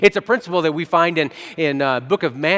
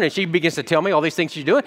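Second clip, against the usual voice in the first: normal talking voice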